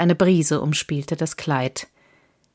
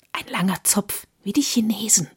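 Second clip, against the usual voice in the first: amused whisper; 4 semitones higher than usual. A narrator's reading. Brother Daniel whispers to Friedrich about the boy, amused whisper. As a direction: breathe it out, tickled by the boy's braid. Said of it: "flüsterte Bruder Daniel amüsiert".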